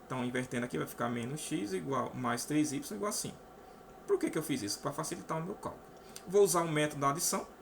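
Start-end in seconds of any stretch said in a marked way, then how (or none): none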